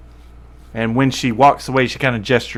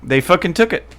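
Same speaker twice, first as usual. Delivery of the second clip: raised pitch, lightly acted distraught soldier; 6 semitones higher than usual. Distraught soldier tells newcomers of the theft, lightly distraught. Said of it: "she's so distraught".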